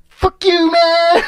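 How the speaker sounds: loud high mocking yell at the thief; yelled taunt. Taunting the car thief in the patrol car, loud gleeful yell. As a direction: shout it in his face, gleeful.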